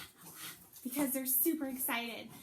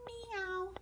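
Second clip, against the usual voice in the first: meow; 8 semitones higher than usual. Pretend. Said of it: "She says".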